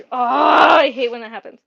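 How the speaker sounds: real exasperation. Real, not acted. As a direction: burst out loudly, annoyed at a glitch. Scale shuts off mid-task.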